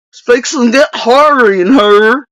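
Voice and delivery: hammed-up hillbilly accent; mock doofus accent. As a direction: parrot it in a doofus country accent. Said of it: "doofus accent".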